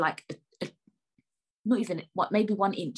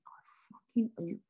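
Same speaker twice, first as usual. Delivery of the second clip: very soft, whispered aside; whispered inner thought; 3 semitones higher, 7 dB quieter than usual. Retold or imitated. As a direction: mouth it under your breath, barely audible. Acts out her silent thought.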